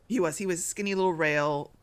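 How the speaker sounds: ordinary talk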